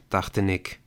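ordinary delivery

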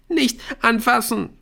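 high-pitched upset cry; distraught girl recoiling; panicked rebuff. A narrator's reading. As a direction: cry out to fend off a hand. Aisha wrenches away from touch.

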